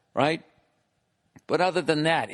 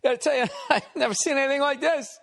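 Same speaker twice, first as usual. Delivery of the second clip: high-pitched cackling through the words; hammed-up hysterical laughter while talking; imitating laughing contractor. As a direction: squeal it out between big mock laughs. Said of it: "the guy was laughing".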